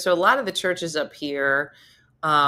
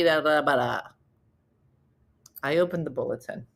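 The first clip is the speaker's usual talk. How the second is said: usual speaking voice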